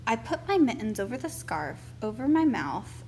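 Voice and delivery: plain reading voice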